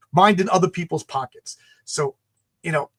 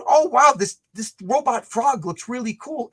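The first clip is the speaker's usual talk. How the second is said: mock-excited, pitch jumping up then easing; lightly hammed-up wow at a robot frog; excited discovery; 5 semitones higher, 3 dB louder than usual. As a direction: play it like a kid spotting a cool toy. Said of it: "I go".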